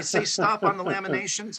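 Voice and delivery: ordinary talk, nothing marked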